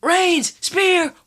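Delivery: high, fast ringside calling; excited play-by-play. Pretend. Kid retelling the match, calling it like live commentary. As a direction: call the finish like a hyped commentator.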